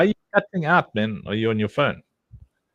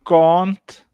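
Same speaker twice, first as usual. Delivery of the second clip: can't with a broad open vowel, kont; South African accent; 4 semitones higher, 5 dB louder than usual. Shown as a demonstration. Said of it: "repeat after me".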